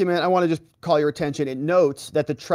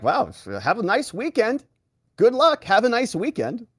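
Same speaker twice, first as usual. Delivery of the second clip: higher, sing-song fake cheer; sarcastic mock; 8 semitones higher than usual. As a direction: taunt them with a falsely cheery goodbye. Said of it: "And he's like".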